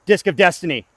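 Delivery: "Disc of Destiny" called out loud and high; loud hype call. Shown as a demonstration. Disc golfer hyping his throw, loud call. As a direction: announce it like a champion.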